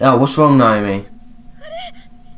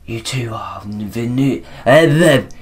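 ghostly voice. Acted. Player reading the ghost's line, ghostly hush. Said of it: "I'm gonna do, like, a ghostly voice".

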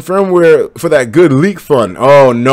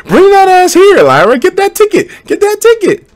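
much higher and louder, daring her to come; challenging shout; 7 dB louder than usual. Real, not acted. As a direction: yell the dare at Lyra. Taunting a viewer to come.

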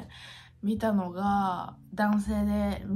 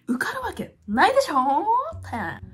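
mock exclamation; 9 semitones higher, 6 dB louder, slower than usual. Exaggerated self-mocking exclamation.

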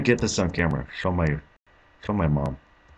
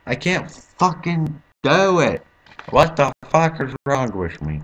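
slow, higher, louder wailing complaint; anguished whine; 8 semitones higher, 6 dB louder, slower than usual. Real, not acted. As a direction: whine out your despair. Despair after failing final jump.

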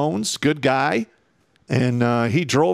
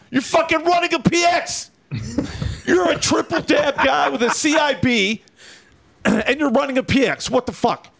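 mock sergeant major yell; 14 semitones higher than usual. Special forces sergeant major yelling at the soldier running the PX. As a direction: bark it up high like an outraged sergeant major. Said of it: "He goes".

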